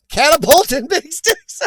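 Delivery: words breaking into high, loud laughter; laughing. Real, not acted. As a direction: crack up while reading it out. Cracks up at a chat comment.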